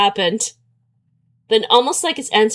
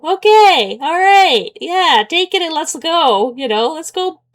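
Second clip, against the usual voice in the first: higher and louder, hurry it up; impatient urging; 6 semitones higher, 4 dB louder, slower than usual. Real, not acted. Urging the game to move on, high and loud.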